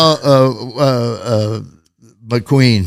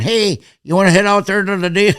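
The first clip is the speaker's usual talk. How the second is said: put-on light Wisconsin dad accent; a hint of a Wisconsin father-in-law; teasing Wisconsin twang; mock Wisconsin accent; 7 semitones higher than usual. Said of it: "'cause I'm mocking him".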